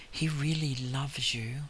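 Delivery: soft, slow, voiced near-whisper; hushed retelling of a whisper; retold whisper. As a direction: say it softly and slowly, as if into someone's ear. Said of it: "I'm just whispering into his ear".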